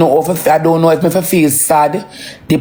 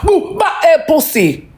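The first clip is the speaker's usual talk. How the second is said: higher, raging yell; angry shout; 9 semitones higher, slower than usual. Real, not acted. Father raging at the man who touched his daughter. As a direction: shout him away in fury.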